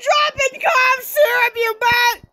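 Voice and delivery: high-pitched, exasperated yelling tirade; screaming rant. Pretend. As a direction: yell at a slacker at the top of your pitch. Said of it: "I'm still yelling; how deafening my screaming is".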